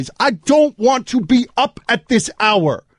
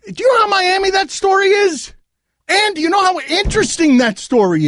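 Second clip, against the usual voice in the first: angry shout; 8 semitones higher than usual. Real, not acted. Host furious about station threat.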